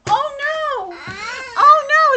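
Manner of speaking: very high, loud wailing; mock panic. Reader to child after the strange voice, mock panic. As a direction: cry out in hammed-up horror.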